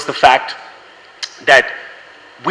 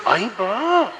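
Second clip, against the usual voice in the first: mock dismay; 7 semitones higher, 5 dB quieter than usual.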